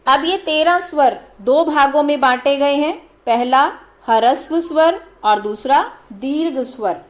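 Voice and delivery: ordinary delivery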